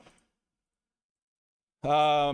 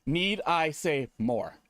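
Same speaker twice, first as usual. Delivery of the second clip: usual speaking voice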